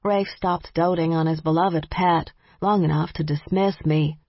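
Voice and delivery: plain reading voice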